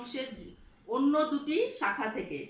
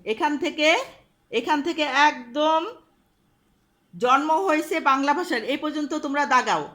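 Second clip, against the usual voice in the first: loud, carrying classroom voice; loud instructions; 8 dB louder than usual. Real, not acted. Teacher gives the class homework, loud classroom voice. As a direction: call it out so the whole class hears.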